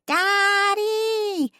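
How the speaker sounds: high, loud squeal of "Daddy"; joyful child yelling for her father; child's shout. A narrator's reading. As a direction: shout it at the top of a little girl's lungs.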